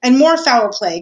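usual speaking voice

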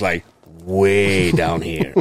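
slow, low drag. Shown as a demonstration. Describing the aunt's low vibe, dragged out slowly. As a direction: drop it way down low. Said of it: "the vibe is".

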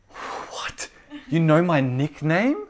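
whispered gasp rising into high disbelief; slow, high, amazed question; rocked, astonished. Retold or imitated. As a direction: imitate someone stunned, hushed then rising sharply. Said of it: "She would be rocked".

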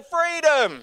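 loud, high single word; leading a call-out. Shown as a demonstration. Pastor modelling the word for congregation, loud. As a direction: punch it out for the room. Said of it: "Everyone say".

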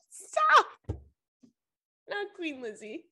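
high laughing protest; real laughter. Real, not acted. Laughing at herself over the Queen joke, real giggles. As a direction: giggle through it, can't stop.

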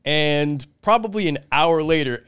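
usual speaking voice

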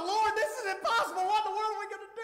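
lightly acted complaint; mock whining. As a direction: play Moses whining to the Lord. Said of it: "started whining a little bit".

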